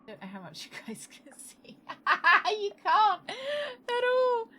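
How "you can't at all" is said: laughing, high and slowed. Real, not acted. Streamer laughing at viewers who see nothing. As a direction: crack up helplessly mid-sentence.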